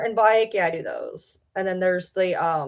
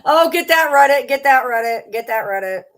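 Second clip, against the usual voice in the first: excited; 4 semitones higher, 7 dB louder than usual. Real, not acted. Excited teasing chant.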